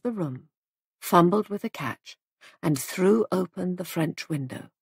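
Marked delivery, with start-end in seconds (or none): none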